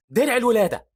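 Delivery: high, loud battle cry; spell shout. Acted. Hero casting at pursuing zombies, shouted spell. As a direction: cast the spell with force.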